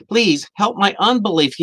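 a father's rising plea for help; pleading plea. Retold or imitated. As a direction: beg him harder this time. Man begs Jesus, repeated.

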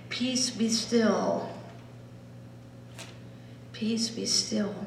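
slow and soft; slow soft meditation; meditative. Shown as a demonstration. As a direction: let it sink in softly. Meditating on the words.

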